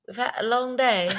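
mock posh British goodbye in a British accent. Shown as a demonstration. Saying goodnight to listeners in a hammy British accent. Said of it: "I should say it in a British accent".

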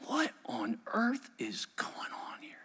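slow, whispered wonder; hushed aside. Real, not acted. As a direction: lean in and whisper slowly. Aside to the congregation.

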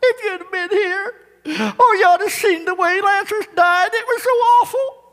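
high-pitched, hammed-up wail; milk it for sympathy in a thin crying voice; tearful pleading Mary. Mary trying to make Jesus feel sorry, mock-weeping. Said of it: "trying to put Jesus on a sad trip".

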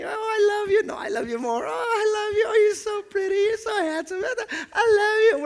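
high-pitched, gushing mock sweethearts; exaggerated squeaky sweet nothings; lovey-dovey couple. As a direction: coo like a hammed-up couple in love. Acting out a lovey-dovey date.

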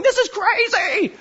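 high, stretched-out cry of amazement; hammed-up, slow high holler of wild excitement; mock frenzy. Preacher acting people jumping from the balconies, mock frenzy. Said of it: "they're jumping out going".

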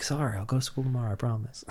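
soft, quick, sheepish mumble; meek apology. Retold or imitated. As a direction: mumble it like a guilty kid. Kid apologizing to angry friends.

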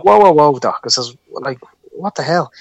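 loud, high whoa, halting someone; alarmed protest. Retold or imitated. Patient stopping consultant as he leaves, alarmed and loud. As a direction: call out in retold disbelief. Stopping the doctor who is leaving.

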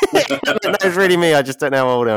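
high, loud, through laughter; laughing. Real, not acted. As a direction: laugh the words out. Laughs at own mix-up.

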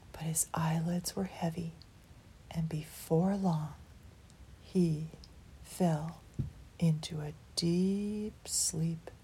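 slow, fading whisper; sleepy whisper. A narrator's reading. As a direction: drift off as you whisper it. Character falling asleep.

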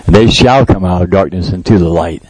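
usual speaking voice

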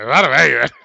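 loud retold answer, delivered as a punchline. Retells his loud punchline answer.

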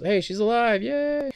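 high, mock-happy yay; mock cheer. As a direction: cheer like it's great news, half joking. Mock celebrating character survival.

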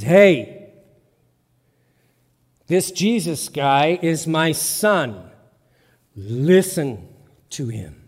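loud booming call, slow and weighty; voice of God. Acted. God speaking to the frightened disciples, loud and slow. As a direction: boom it down from heaven. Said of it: "the voice of God comes down".

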